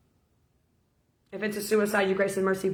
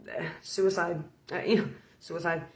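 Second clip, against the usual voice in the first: sheepish admission; 3 semitones lower, 6 dB quieter than usual. Retold or imitated. Newly dead man admits suicide.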